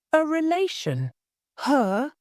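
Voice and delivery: high, shocked questions; outraged cry. A narrator's reading. Maid shocked at lawyer's mistake, high and outraged. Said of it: "she cried".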